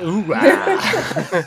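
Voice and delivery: high laughing hoorah; mock military cheer. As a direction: chant it like a grunt.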